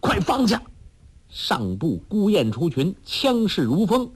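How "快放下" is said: sharp, high command; shout. A narrator's reading. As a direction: yell for him to stop. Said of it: "完颜康叫道".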